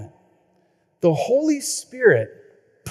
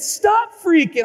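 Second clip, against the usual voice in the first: calm company reply; 11 semitones higher, 3 dB louder, slower than usual. Retold or imitated. Company voice on phone.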